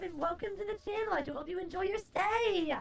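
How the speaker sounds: usual speaking voice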